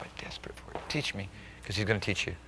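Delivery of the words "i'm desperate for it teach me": hushed, desperate prayer; whispered plea. Retold or imitated. A believer begging God to teach him, whispered. Pleading prayer voiced quietly.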